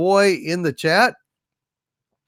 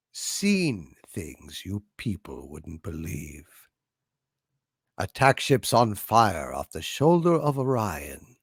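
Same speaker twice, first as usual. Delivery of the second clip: dying monologue; 9 semitones lower, 5 dB quieter, slower than usual. Acted. Performs the dying replicant monologue.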